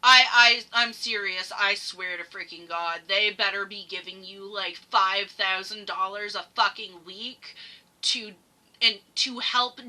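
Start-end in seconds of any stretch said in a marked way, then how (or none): none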